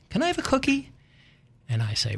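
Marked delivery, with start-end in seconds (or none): 0.1-0.9 put-on much higher, sweet little-girl voice; three-year-old girl. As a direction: ask for the cookie like a tiny toddler. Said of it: "she'd say".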